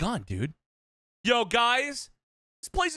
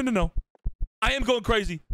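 ordinary talk

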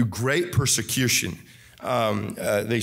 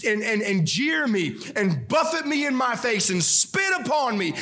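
impassioned preaching; 6 semitones higher than usual. Acted. Preacher voicing Jesus's suffering.

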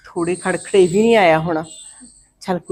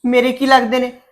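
indignant retort; 5 semitones higher, 5 dB louder than usual. Acted. Mother disowns her brother.

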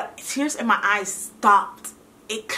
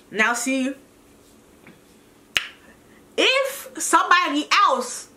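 ordinary talk, nothing marked

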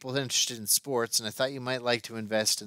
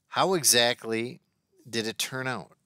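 ordinary delivery, nothing marked

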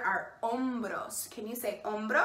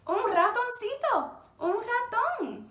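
squeaky mouse voice; 10 semitones higher than usual. Shown as a demonstration. Introducing the little mouse.

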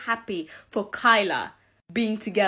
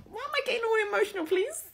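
higher and fast, a gushing mock whine; mock teary exasperation; 11 semitones higher, 5 dB quieter, faster than usual. Watching Love Island, mock whining fast and much higher. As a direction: rush it out, laughing at yourself. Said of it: "I'm like".

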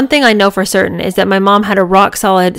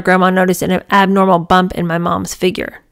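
plain reading voice

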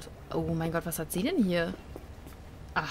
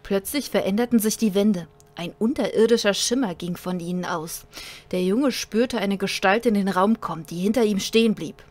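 dramatic narrator reading a sci-fi tale; story narration. A narrator's reading. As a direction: read it like an audiobook.

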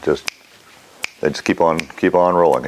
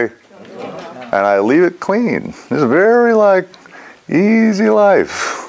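slow blissful; 12 semitones higher, much slower than usual. Real, not acted. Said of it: "blissful drawn-out high lines".

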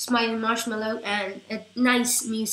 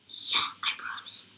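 whisper; 7 dB quieter than usual. Real, not acted. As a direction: breathe the promise as a secret. Whispered promise to viewers.

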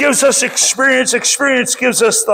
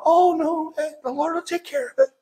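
softer, airy mock of a carefree brush-off; calm, unbothered; 5 semitones higher, 7 dB quieter than usual. Preacher mocking friend's reply to his fuming, soft unconcerned brush-off. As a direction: toss it off quietly like nothing matters. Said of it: "calm, cool, I don't care voice".

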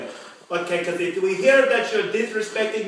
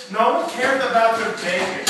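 normal talking voice

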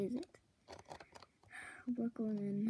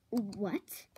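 ordinary talk

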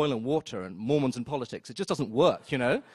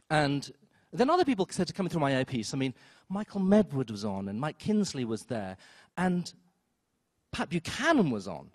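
ordinary delivery

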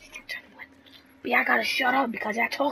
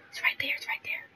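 whisper; 8 dB quieter, faster than usual. Real, not acted. Spotting monster, hushed excitement.

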